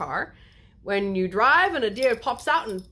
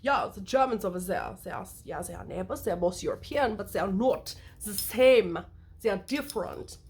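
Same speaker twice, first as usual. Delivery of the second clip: put-on German accent. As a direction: play a German explaining the neighbours. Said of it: "this accent is not, not related".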